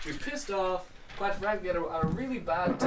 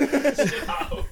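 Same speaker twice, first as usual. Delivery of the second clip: high, louder laughing yell; mock yell; 9 semitones higher, 5 dB louder than usual. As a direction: bellow it with a laugh. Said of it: "No, not calm down".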